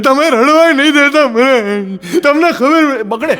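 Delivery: high, slow, teary bride voice, half laughing; a sobbing bride teasing the groom, slow and high; bride's whiny reply. Retold or imitated. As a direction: drag it out like a weepy new bride.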